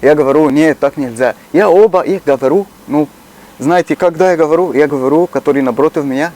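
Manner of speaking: usual speaking voice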